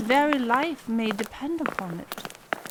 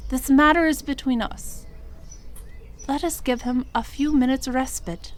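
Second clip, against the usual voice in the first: ordinary delivery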